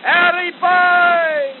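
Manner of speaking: long, slow, high bellow; soldiers' shout. Acted. Thirsty soldiers shouting for the water carrier, loud. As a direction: call it out across the siding. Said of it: "we shouted".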